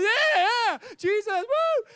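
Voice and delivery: very high, loud whooping praise; ecstatic shouting. Retold or imitated. Man running around the church, wildly shouting praise. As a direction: whoop it up with wild joy. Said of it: "they're just going".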